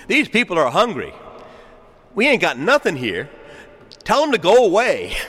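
high, punchy brush-off; dismissive church voice. Acted. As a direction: act out a church turning people away. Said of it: "should never speak like that".

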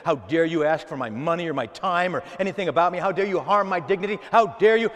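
acted outrage building to loud; indignant rant, climbing in pitch and volume; worked-up indignation. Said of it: "We're all worked up".

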